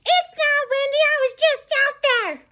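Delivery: very high falsetto retort; screechy caricature, arguing back; mocked supervisor. Supervisor disputing the wind over the phone, mocked high screech.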